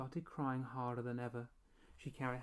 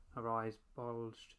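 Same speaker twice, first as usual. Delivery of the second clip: ordinary delivery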